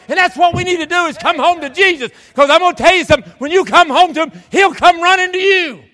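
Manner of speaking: high, loud altar-call shout; full-force preaching. Real, not acted. Preacher urging congregation home to Jesus, full-force preaching. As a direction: press the promise on them at full volume.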